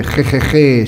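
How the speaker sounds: "je" with a rasped Spanish J; South American J. Shown as a demonstration. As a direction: demonstrate je from the back of the mouth. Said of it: "I'm from South America and we do".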